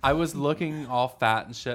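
usual speaking voice

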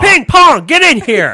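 put-on shouting for the kids; yelling full name. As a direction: bellow them in from outside. Yelling kids' names.

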